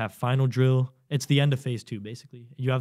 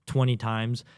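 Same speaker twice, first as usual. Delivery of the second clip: ordinary talk, nothing marked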